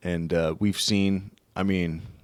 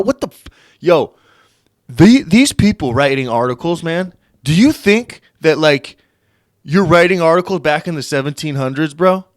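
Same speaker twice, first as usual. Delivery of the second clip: louder, higher, building irritation; angry rant; 7 semitones higher, 6 dB louder than usual. Real, not acted. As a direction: vent at the writer, getting louder. Ranting at the article's writer.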